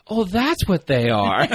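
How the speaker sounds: high, drawn-out dawning realization; Jerry. Retold or imitated. Retelling a cartoon scene to friends, mock realization. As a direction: act out a slow, high-pitched realization. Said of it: "and Jerry's like".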